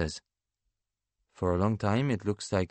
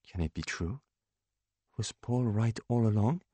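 ordinary delivery, nothing marked

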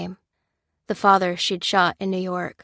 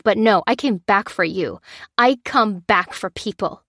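higher, louder furious defence; spitting anger; 4 semitones higher, 6 dB louder than usual. A narrator's reading. Lucy defending herself to Lopez, loud spitting anger. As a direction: spit the words at him. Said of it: "said Lucy, spitting".

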